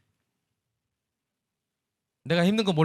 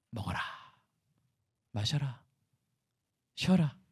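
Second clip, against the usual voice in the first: soft, gentle words of God to the weary soul; tender; 3 semitones lower, 8 dB quieter than usual. Retold or imitated. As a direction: comfort the exhausted prophet softly. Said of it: "영혼을 보시고 하나님이". (지친 선지자를 달래는 하나님, 부드럽고 다정하게)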